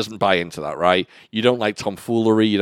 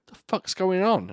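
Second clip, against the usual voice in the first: retold bewilderment; 9 semitones higher than usual. Retelling discovering bike gone.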